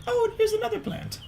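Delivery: very high, fast chirpy mom voice; high mom impression. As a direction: squeak it out like a breezy mom. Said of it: "and then she's like".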